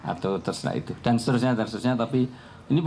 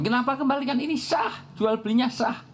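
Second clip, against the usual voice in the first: protesting son; 13 semitones higher than usual. Retold or imitated. Son protests to his father.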